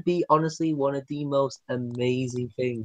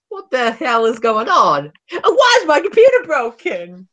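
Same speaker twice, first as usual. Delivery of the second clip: rising to much higher outraged mock yelp; imitating Joe; 9 semitones higher than usual. Joe finding his broken computer, higher outraged mock yelp. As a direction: play the baffled, furious owner. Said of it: "Joe was just watching this, like".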